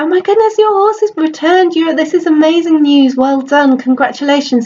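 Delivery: high, gushing, thrilled voice; bursting with delight for his friend; excited neighbour. Acted. Neighbour congratulates the farmer on the returned horses, high and gushing.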